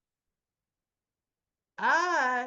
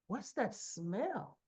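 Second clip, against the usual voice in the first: slow, softer, drawn-out question; imitating a suspicious brother; 3 semitones lower, 7 dB quieter, much slower than usual. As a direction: ask it slowly, sniffing out trouble. Brother sniffs out something suspicious.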